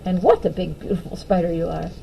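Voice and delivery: soft, low cooing to a pet. Shown as a demonstration. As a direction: coo softly at the spider. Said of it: "soft cooing to spider".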